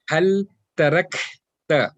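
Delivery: slow "tarakta" with airy K before "ta"; hams on kaf. Shown as a demonstration. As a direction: hold "tarak", let the K flow, then "ta". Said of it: "whenever you are saying it with the sukoon".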